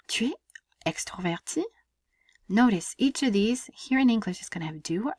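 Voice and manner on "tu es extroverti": "Tu es extroverti" climbing to the end of "extroverti"; rising question intonation. Shown as a demonstration. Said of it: "make my voice go up at the end".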